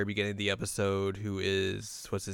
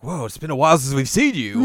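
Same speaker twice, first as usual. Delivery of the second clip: mock surprise; 5 semitones higher, 8 dB louder than usual. Voicing surprised friend.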